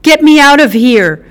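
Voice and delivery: rising into a high, loud shriek. Acted. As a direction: panic building to a shriek on the last words. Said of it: "Carlotta shrieked".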